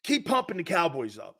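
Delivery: usual speaking voice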